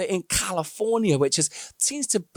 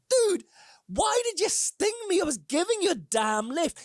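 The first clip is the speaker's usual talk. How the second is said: indignant frog; 11 semitones higher, 3 dB quieter than usual. Retold or imitated. Frog complains in afterlife.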